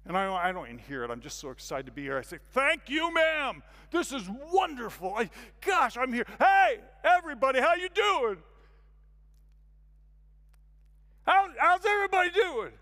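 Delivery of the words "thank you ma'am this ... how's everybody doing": high, loud, gushing greeting; eager newcomer. Retold or imitated. Newcomer calling hello to everyone in the room, overeager. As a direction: beam and call out to the whole room.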